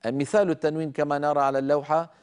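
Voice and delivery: normal talking voice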